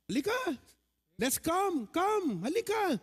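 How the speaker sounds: acting out an invitation to a friend; calling someone over. Shown as a demonstration. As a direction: beckon them, halika. Pastor acts out inviting someone.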